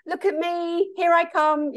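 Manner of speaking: high, showy "look at me" voice; a hammed-up show-off announcing herself; mock attention-seeker. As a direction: flaunt yourself, lightly mocking. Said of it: "there couldn't have been more of a".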